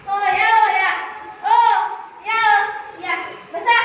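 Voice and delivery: loud calls to gather; calling out. Acted. Patil calling villagers to the doctor's meeting, loud calls. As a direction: shout everyone over to sit.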